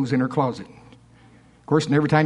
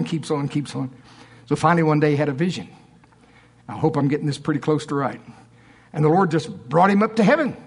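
usual speaking voice

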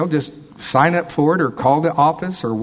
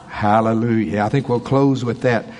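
ordinary talk

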